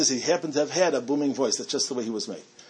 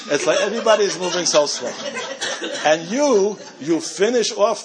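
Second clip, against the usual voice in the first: laughing; 5 semitones higher, 4 dB louder than usual. Real, not acted. Laughing while mocking slow clerks.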